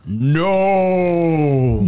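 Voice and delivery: long drawn-out "no" in a put-on voice; another man's voice. Dad imitates his possessed daughter, man's voice. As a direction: imitate a possessed child answering as a man. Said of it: "Con otra voz, no era su voz".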